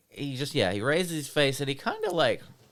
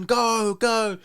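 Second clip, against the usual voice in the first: 1 retold cheer; 9 semitones higher, 4 dB louder than usual. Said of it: "everyone's screaming".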